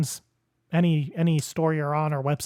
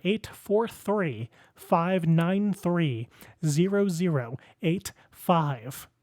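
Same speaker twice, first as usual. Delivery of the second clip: each number slowly spelled out; slow and deliberate; much slower than usual. Shown as a demonstration. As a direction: drag every digit out clearly. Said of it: "I will say it one more time".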